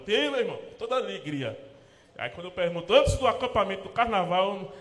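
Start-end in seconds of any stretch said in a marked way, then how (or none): 0.0-1.7 cheerful pastor. Retold or imitated. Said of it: "Aí eu".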